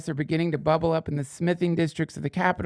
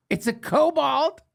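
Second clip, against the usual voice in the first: high, slow, incredulous echo of the question; mock disbelief; 10 semitones higher, slower than usual. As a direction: pretend to be shocked it's a kobold. Mocking the word lesser.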